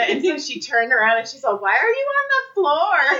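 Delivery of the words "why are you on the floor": high-pitched imitation of a drunk old woman; drunk old lady. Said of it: "a drunk old lady".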